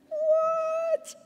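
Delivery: very high startled squeak; shocked what. Acted. Disciples startled as Jesus appears, squeaking in shock. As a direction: squeak it out in disbelief.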